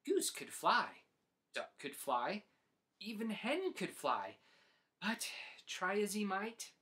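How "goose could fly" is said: usual speaking voice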